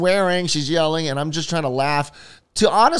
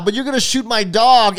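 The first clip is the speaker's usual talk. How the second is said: raised, louder voice of mock outrage; indignant comeback; 7 semitones higher, 6 dB louder than usual. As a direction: protest the threat, high and loud. Acted comeback to the threatening neighbour.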